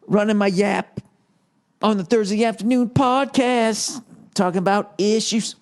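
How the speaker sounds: mocking his own podcast. Real, not acted. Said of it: "I'm mentally fucking exhausted".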